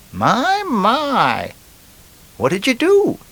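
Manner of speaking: very high, put-on lady voice; lady in a joke. Lady hears of a thirty-year sentence, high mock lady voice.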